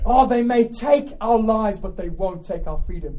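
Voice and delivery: high, loud, fired-up sermon; impassioned pulpit exhortation; full-force preaching. Real, not acted. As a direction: preach it hard to rouse the congregation.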